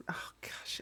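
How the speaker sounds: usual speaking voice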